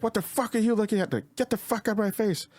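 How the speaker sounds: high-pitched imitation of a yelling bride; mock yelling. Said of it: "she yells and, like, she's yelling".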